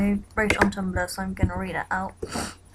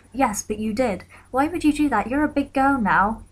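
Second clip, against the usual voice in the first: scolding woman, pushed harder; 5 semitones higher, 4 dB louder than usual. Retold or imitated. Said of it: "And she said".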